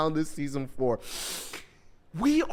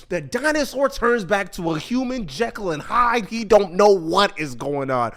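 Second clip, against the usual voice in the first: usual speaking voice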